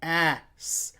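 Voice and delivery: "Ass" dropped h, drawn out; sounding out. Shown as a demonstration. Teacher acting out hearing the word. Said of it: "And I go".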